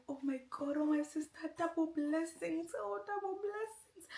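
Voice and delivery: high squealing joy, imitating sister's reaction; overjoyed. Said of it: "J J was so happy".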